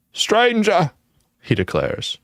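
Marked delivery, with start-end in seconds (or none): 0.1-0.9 put-on high, wavering old man's voice; quavering old man. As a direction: quaver it like an ancient ghost. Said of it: "in a quavering voice".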